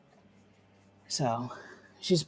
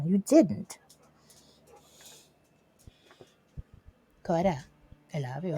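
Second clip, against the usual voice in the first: higher sing-song pet talk; 5 semitones higher, 7 dB louder than usual. Real, not acted. Owner doting on her dog at her desk. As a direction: dote on the dog playfully.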